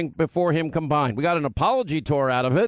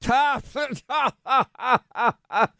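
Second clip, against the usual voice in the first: exaggerated staccato fake laugh, higher; high mocking "ha, ha, ha"; mock laughter; 6 semitones higher than usual. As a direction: imitate everyone laughing in hammed-up, evenly spaced ha-ha-has. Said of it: "everyone's laughing".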